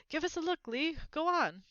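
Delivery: keen to see the box; eager boy. A narrator's reading. Crowd of boys eager.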